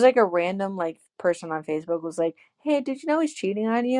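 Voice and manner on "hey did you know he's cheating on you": higher-pitched imitation of a tipster; lightly voiced stranger breaking the news; gossipy tipster. Voices a random Facebook tipster.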